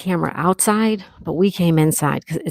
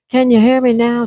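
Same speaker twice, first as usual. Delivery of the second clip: higher, louder repeat to be heard; louder mic check; 4 semitones higher, 6 dB louder than usual. Real, not acted. Streamer retrying the mic for viewers, louder. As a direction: raise your voice to check the mic.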